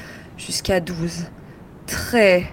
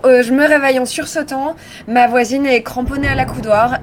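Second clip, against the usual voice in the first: normal talking voice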